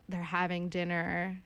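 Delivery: normal talking voice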